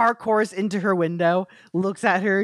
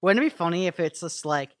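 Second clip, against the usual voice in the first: usual speaking voice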